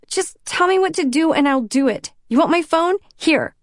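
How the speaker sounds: upset voice breaking apart; voice cracking. Acted. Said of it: "My voice cracks".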